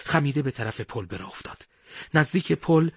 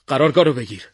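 raised, urgent command; louder commanding call; radio order; 2 semitones higher, 9 dB louder, faster than usual. A narrator's reading.

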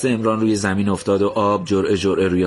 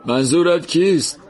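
grieving man; 9 semitones higher than usual. Acted. Man asks back.

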